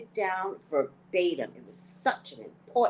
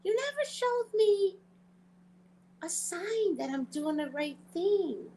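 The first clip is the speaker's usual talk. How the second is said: pleading prayer; 8 semitones higher than usual. Retold or imitated. Asking God for a sign before her trip, in a high, pleading voice. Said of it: "and I go".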